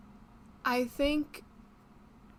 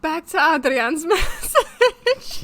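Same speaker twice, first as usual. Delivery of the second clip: laughing; 6 semitones higher, 11 dB louder, slower than usual. Real, not acted. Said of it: "laughs through line".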